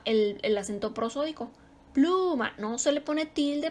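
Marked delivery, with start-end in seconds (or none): 1.9-2.5 Pluma with PLU pitched high; teacher lifting PLU high in Pluma; stress on PLU. Shown as a demonstration. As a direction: punch up the first syllable of Pluma. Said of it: "ahí está lo más fuerte".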